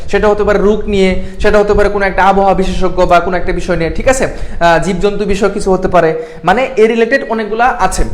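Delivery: ordinary delivery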